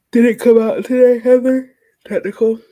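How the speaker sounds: loud, high excited cry; excited outburst. Real, not acted. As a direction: burst out with sudden excitement. Excited reading a chat comment.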